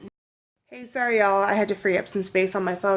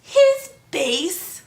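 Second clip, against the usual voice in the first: put-on high, loud old-lady quote; imitated old lady; 8 semitones higher, 6 dB louder than usual. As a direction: gush it like an old churchgoer. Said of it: "She's like".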